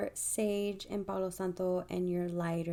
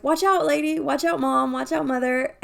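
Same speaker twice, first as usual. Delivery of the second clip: a mock yell, higher and louder; retold warning yell; 7 semitones higher, 11 dB louder than usual. Replaying how she warned her mom in the store, mock shout. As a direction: repeat the warning as a half-played shout. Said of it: "So, like".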